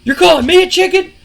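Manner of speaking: loud, high indignant outburst; outraged Bret. Retold or imitated. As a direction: bristle at the insult, voice shooting up. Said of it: "Bret's like".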